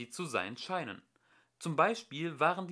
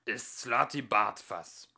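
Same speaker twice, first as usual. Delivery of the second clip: slow, louder Slati Bartfass; slow weighty name; 5 dB louder, slower than usual. A narrator's reading. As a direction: announce the name solemnly. Old man names himself.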